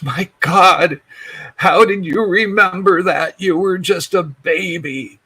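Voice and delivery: mother stunned that her son remembered; amazed exclamation. Retold or imitated.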